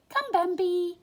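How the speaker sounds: high, loud friendly call; shout. A narrator's reading. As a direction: rabbit calling his friend over, loud. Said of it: "shouted Thumper".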